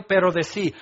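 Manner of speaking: normal talking voice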